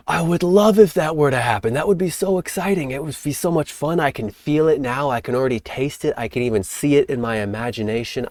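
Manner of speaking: dream aloud as if you can already taste it; excited longing. Shown as a demonstration.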